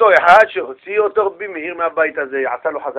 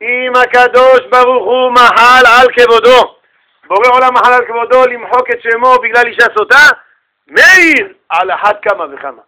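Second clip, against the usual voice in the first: measured, forceful pronouncement; emphatic rabbinic argument; 4 semitones higher, 5 dB louder than usual. Acted. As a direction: proclaim the principle weightily, word by word.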